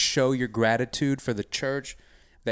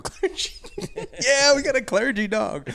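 high excited laughing repeat. Acted. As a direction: burst out gleefully.

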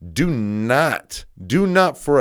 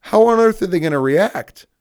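exasperated question; 9 semitones higher, 6 dB louder than usual. Retold or imitated. Challenging parents in quoted speech.

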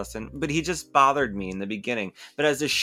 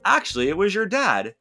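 higher, emphatic reveal; retold confession; 8 semitones higher than usual. Slater confessing old rivalry to the son, emphatic. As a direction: lift each revelation higher.

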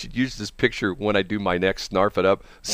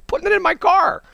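exasperated outburst; 6 dB louder than usual. Real, not acted. Indignant exclamation.

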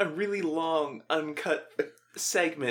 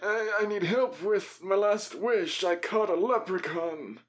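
hammy customer; 6 semitones higher, slower than usual. Pretend. Customer role.

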